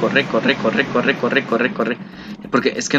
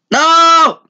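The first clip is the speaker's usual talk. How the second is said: loud high cry of no; despairing shout; 14 semitones higher, 6 dB louder than usual. Real, not acted. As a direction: yell it in despair. Losing in the game.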